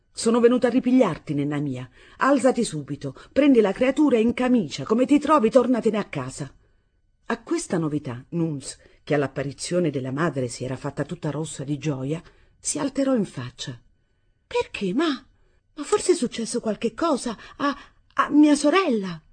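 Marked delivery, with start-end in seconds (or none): 0.1-6.5 raised, louder, forceful orders; fierce resolve. A narrator's reading. Mother come to take her daughter home, fiercely resolute. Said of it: "con risolutezza selvaggia". 14.5-19.2 high-pitched, anxious questions; alarmed questioning. A narrator's reading. Daughter to mother about her sister, alarmed.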